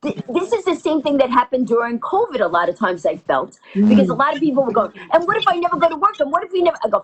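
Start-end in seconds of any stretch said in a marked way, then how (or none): 5.1-6.8 high, fast fretting; mock worrying. People to friend during covid lockdown, anxiously fretting. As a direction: imitate someone spiralling into what-ifs.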